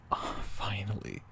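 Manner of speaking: slow, relieved whisper. Acted. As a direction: breathe it out slowly in a whisper. Relieved at partner's free time.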